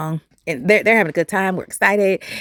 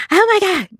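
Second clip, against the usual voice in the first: high, louder oh my God; stunned cry after the crash; shocked exclamation; 12 semitones higher, 4 dB louder than usual. Retold or imitated. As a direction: gasp it out in shock after the hit. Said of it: "I was like".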